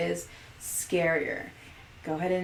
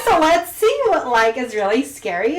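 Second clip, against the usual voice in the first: put-on bubbly Valley Girl, heavy on "like"; 4 semitones higher, 7 dB louder than usual. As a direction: play up the Valley Girl talk, a bit louder. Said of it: "Why did I just sound like a Valley Girl".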